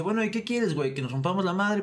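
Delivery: high, defiant dare to fight; defiant challenge. Retold or imitated. Narrator daring the neighbour to fight, high defiant voice. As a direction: square up and dare him.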